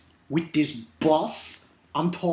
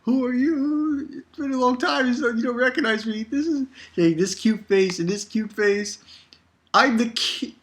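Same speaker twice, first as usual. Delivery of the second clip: high, put-on boastful voice; Koon's voice; 8 semitones higher than usual. Koon bragging to Ichigo, high put-on voice. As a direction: brag to Ichigo in a high silly voice. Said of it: "right in front of Ichigo saying".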